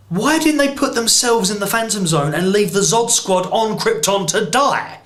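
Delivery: high, exasperated disbelief; incredulous rant. Real, not acted. Reviewer ranting at the film's logic, high and incredulous. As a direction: rant it up high, baffled at the plot. Ranting about Zod's escape plan.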